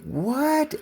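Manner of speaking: high, incredulous one-word reaction; retold disbelief. Customer reacting to the owner's view, incredulous. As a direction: shoot the pitch up in disbelief.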